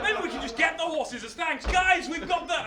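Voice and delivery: high, eager call; hopeful calling out. Acted. Hercules hopes for horses as thanks, eager calling. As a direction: call to the lads with sudden hope. Hercules calls to his men.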